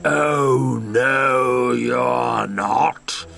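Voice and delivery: slow, lightly voiced greedy troll; a hint of a hungry troll gloating. Troll threatening to eat the little goat, mildly gloating troll voice.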